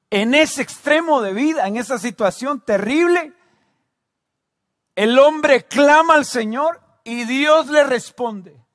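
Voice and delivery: loud, high, urgent pulpit delivery; fervent preaching. Real, not acted. Preaching at full force.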